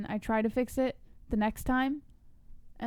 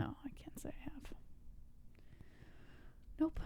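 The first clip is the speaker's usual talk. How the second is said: whispered reply; 6 semitones higher, 17 dB quieter than usual. Real, not acted. Whispered dry answer.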